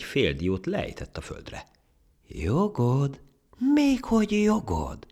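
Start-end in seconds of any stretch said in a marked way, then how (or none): 2.3-5.1 very high, loud, incredulous echo; scornful disbelief. Acted. Monkey echoing the ant's claim, scornfully incredulous. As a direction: scoff at the very idea, drawn out.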